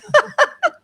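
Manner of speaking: sudden high burst of laughter; real laugh. Real, not acted. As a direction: laugh out at your own words. Laughs at own phrase.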